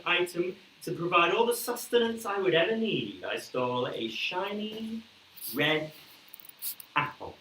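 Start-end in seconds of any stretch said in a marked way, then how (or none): none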